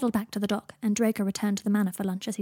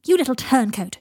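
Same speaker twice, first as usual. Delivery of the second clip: loud, higher burst of outrage; indignant shout; 4 semitones higher, 8 dB louder than usual. A narrator's reading. Draco, given away from the pantry, shouts indignantly. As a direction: shout it at the little traitor. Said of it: "shouted Draco".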